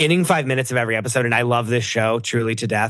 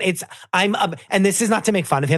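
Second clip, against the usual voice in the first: ordinary talk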